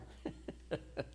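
soft, breathy chuckle trailing off; quiet real laughter. Real, not acted. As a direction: chuckle helplessly under your breath. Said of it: "forgive me for laughing".

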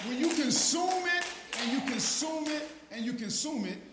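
high, loud shouted sermon; preaching shout. Acted. Preacher defying the devil before his congregation, shouting. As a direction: thunder it at the devil.

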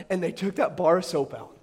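ordinary delivery

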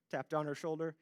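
ordinary talk

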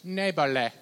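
playful best-attempt Australian accent. Shown as a demonstration. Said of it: "Australian accent".

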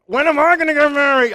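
high, loud whiny wail; hammed-up lament over still being single; mock self-pity. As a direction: whine about your own luck. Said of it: "you're like".

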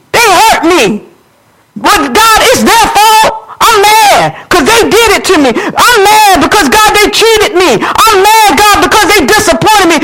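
high, driving sermon exhortation; insistent pulpit cry, hammering each phrase; impassioned preaching. Real, not acted. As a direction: preach it forcefully, pitch held high. Preaching at full force.

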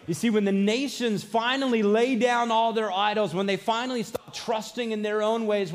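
rising preacher's fervor; impassioned preaching. Acted. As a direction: preach it with growing fire. Preaching builds to full force.

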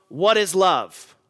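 normal talking voice

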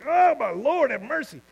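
high, loud exasperated Oh my Lord; exasperated cry. Retold or imitated. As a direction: throw up your hands in mock despair. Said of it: "and I'm like".

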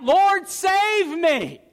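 loud, high, drawn-out cry for rescue; slow, full-voiced shout for help; desperate cry. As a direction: cry out like a drowning man. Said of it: "He cried out".

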